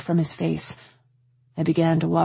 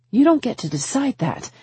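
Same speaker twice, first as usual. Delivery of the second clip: higher-pitched, offended retort; indignant rebuke; 8 semitones higher than usual. A narrator's reading. Woman rejecting suitor's meddling, indignant. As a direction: put him firmly in his place.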